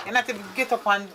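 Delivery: normal talking voice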